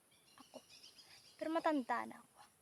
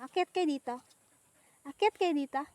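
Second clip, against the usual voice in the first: loud coaxing call; louder call, urging the animals up; 9 dB louder than usual. Real, not acted. Calling animals up here. (malakas na pagtawag na nag-aanyaya)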